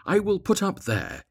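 high-pitched happy exclamation; pleased cry. A narrator's reading. Swallow spotting a place to sleep, high pleased cry. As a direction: cry it out as a delighted find. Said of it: "he cried".

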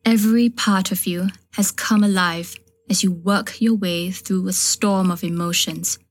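ordinary delivery, nothing marked

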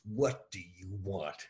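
slow, heavy big-man voice; unbothered tough guy. Retold or imitated. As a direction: drag it out like a bored giant. Giant enforcer Gillies answers the punch.

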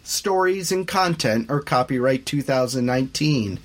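loud brisk podcast outro; announcer read. A narrator's reading. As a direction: sell the show to listeners.

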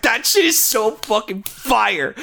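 high, drawn-out hyped raving; thrilled fan reaction. Retold or imitated. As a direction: gush slowly, stretching every word with excitement. Retells own thrilled reaction to scene.

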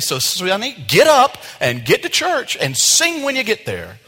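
hammed-up nagging parent. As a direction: hurry the kid out the door. Parent ordering kid to church.

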